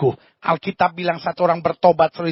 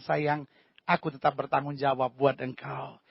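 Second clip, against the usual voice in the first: softer, lower tender reassurance; tender husband; 5 semitones lower, 10 dB quieter than usual. Acted. Husband hugs angry wife, soft and tender. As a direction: hold her and reassure softly. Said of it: "dia peluk dan bilang".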